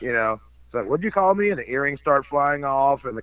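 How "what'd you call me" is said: offended retort. Retold or imitated. Retold offended reaction.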